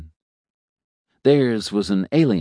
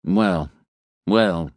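plain reading voice

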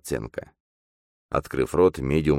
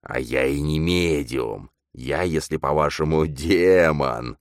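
slow, drawn-out demon voice; possessed medium, savouring the words; smirking demon; slower than usual. A narrator's reading. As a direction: smirk through a demonic growl. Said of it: "ухмыльнулся парень".

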